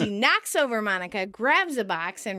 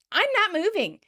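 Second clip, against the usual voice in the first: high-pitched indignant refusal; imitating an indignant roommate; 9 semitones higher than usual. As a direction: dig in, affronted. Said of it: "with an indignant tone".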